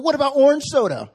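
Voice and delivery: high, loud, laughing ask; sister's eager question. Retold or imitated. Sister excitedly asking the pastor about orange soda, high and loud. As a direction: fire off the question, half laughing. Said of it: "She said".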